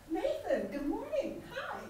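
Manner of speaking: bright high delighted hello; excited morning greeting; enthusiastic greeting. Shown as a demonstration. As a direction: greet him like you're thrilled to see him.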